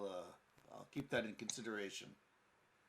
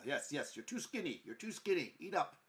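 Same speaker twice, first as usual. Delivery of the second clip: higher, insistent nagging; fussing hostess; 6 semitones higher, 4 dB louder than usual. Acted. Hostess urging the pilot to eat, higher and insistent. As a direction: fuss over the skinny guest. Mother-like host urges eating.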